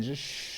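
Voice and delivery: 쉬 as a long hushing sh; sh sound. Shown as a demonstration. Teacher likening SH to baby's pee sound, hushing sh. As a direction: say 쉬 like shushing a baby to pee. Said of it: "애기들 오줌 내릴 때 나는".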